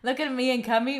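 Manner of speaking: usual speaking voice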